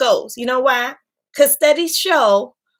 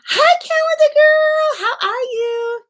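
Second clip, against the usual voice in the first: excited greeting; 12 semitones higher than usual. Real, not acted. Greeting a viewer by name.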